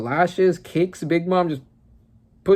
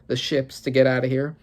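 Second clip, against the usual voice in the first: ordinary talk, nothing marked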